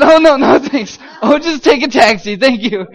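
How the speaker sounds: loud, high, flustered no thanks; panicky polite refusal. Retold or imitated. Freed suspect refusing officer's ride home, flustered. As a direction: turn it down loudly and in alarm.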